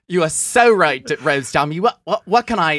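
loud, high Australian Val voice; Australian accent. Pretend. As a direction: keep the hammy Australian accent going. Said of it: "We have Val from Glow Up here".

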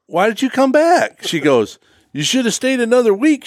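high, mock-scolding wife voice; teasing wife. Wife ribbing her husband about his early return, high and teasing. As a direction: tease him for coming home early. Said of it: "my wife says".

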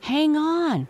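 high, loud yell. A narrator's reading. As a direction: yell it as the rock looms. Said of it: "Susan yelled".

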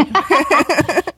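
bright burst of laughter; high laugh. Real, not acted. Laughing at a pun.

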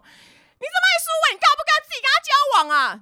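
very high screeched fury, speeding up; mock scream. Regina tells friend to date Suwei herself, mock screaming. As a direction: imitate her howling at the heavens. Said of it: "对天嘶吼说什么".

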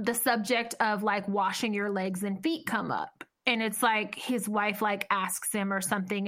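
usual speaking voice

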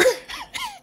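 high burst of real laughter; short high laugh. Real, not acted. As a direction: laugh out at the irony. Laughs at the punchline.

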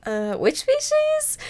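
high, small voice of confusion; lost beginner. Retold or imitated. New birder asking the experts, high and bewildered. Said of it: "And I'm like".

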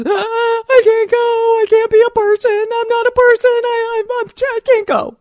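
high, wailing, falling-apart voice; wailing despair. Retold or imitated. To boyfriend in bed, high wailing despair. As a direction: whimper it out like you're falling apart. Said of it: "and I was".

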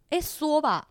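mock Taiwanese Mandarin, high; Taiwanese-accented Mandarin. Shown as a demonstration. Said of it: "一定要用台湾国语".

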